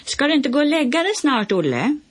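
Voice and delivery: playful yell from window to window; shouting across. A narrator's reading. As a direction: call loudly across the gap between houses. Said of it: "skrek Lasse".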